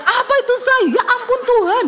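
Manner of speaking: acted high-pitched yell of alarm; retold panicked shout. Wife re-enacts shouting to husband as exhaust falls, high yell. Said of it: "Saya teriak".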